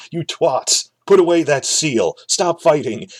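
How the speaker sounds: mock-yell at two brawlers to break it up; imitated yelling. Baratheon yelling at the fighters to stop, mock yell. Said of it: "Baratheon is yelling at them".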